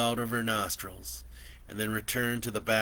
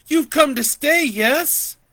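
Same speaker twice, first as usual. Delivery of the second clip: high, loud, pleading auntie; eager auntie; 14 dB louder, slower than usual. Acted. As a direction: beg him to stay in a high voice. Auntie voiced.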